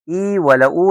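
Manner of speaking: "اي ولا او" as long ee or oo; "اي ولا او" as the mistaken names of French E; wrong letter names. Shown as a demonstration. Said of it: "محدش بقى يجي يقول لي".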